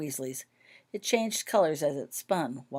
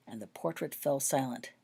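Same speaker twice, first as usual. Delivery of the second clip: plain reading voice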